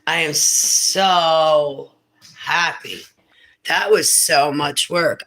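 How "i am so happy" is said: ordinary talk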